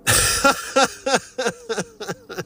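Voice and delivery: a long high giggle; laugh. Real, not acted. Admitting she had to breathe, long high giggle. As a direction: giggle at your own temper.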